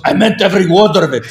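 Middle Eastern dad. Retold or imitated. Dad doubling down to his son, imitated Middle Eastern accent. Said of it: "My dad was from the Middle East".

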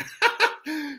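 high giggling burst; real laugh. Real, not acted. Poet amused by own line, real laugh.

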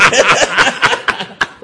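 high burst of laughter; cracking up, high and loud. Real, not acted. Host cracks up.

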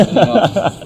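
high, loud amused burst; excited high yelp. Real, not acted. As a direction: toss it out high and loud, laughing. Laughing aside after joke.

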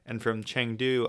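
usual speaking voice

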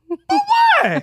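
very high, outraged "for what"; shocked high-pitched protest; indignant squeak. Retold or imitated. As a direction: squeal it out like a wronged kid. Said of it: "And I'm just like".

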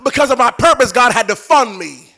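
high, loud, driving sermon; impassioned preacher in full cry; full-force preaching. Real, not acted. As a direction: declare it boldly to the whole congregation.

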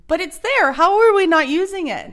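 rising to a passionate outburst; excited. Real, not acted. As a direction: push it up with passion. Passionate rant.

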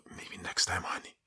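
breathy voiceless whisper, flirty; teasing whisper. Retold or imitated. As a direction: lean in and whisper it slyly. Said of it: "En toen ging ik fluisteren over". (plagerig gefluisterd)

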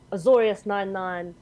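booming police call. Acted. To the Orzhov advocate, a booming arrest call. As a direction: announce the arrest like a herald. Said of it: "use my booming voice".

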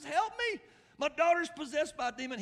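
high, loud cry for help; very high, urgent shout; desperate plea. Retold or imitated. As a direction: act out a mother crying out in desperation. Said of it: "she came to him pleading".

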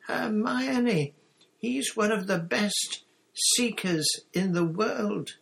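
high, emphatic, scandalised protest; incredulous. A narrator's reading. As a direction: insist in high-pitched disbelief. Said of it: "as though he couldn't believe his ears".

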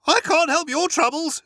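high, loud and firm; Motty brushes off Bertie's worry, high and loud. Acted. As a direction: flatly refuse to care. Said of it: "said Motty firmly".

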